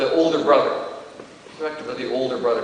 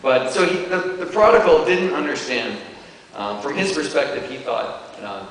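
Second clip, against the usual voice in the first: ordinary talk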